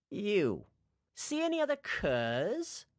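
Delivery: slow, taunting villain voice; menacing villain. Acted. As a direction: draw out the threat. Towin threatens the cook.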